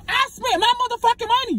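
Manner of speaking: laughing through the words; high laughing protest. Acted. Laughing during the argument.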